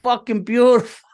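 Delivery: high mock Bobby, loud and gleeful; Bobby impression. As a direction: ham up a buddy who's all in on the cabin. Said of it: "I could see Bobby going".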